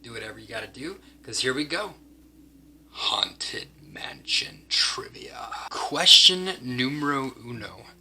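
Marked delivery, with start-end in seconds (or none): 2.9-5.5 slow, drawn-out eerie whisper; spooky whisper. Pretend. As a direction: breathe the title out like a ghost. Eerie title announcement.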